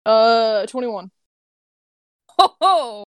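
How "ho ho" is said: gleeful gloat. Real, not acted. Player gloats over high roll.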